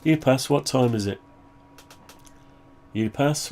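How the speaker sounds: usual speaking voice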